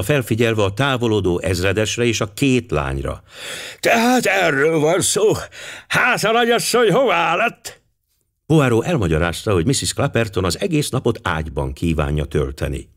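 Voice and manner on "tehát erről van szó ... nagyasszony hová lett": high-pitched, put-on old man's voice; exclaiming old general. A narrator's reading. Old general asking about the absent wife, in high exclamation. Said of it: "kiáltotta".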